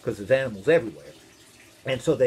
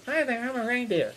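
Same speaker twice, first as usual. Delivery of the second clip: higher, cheery reindeer introduction; a hint of talking reindeer; lightly voiced reindeer; 6 semitones higher than usual. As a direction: introduce yourself as a cartoon reindeer, pitched up. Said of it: "these reindeer are getting onto the boat".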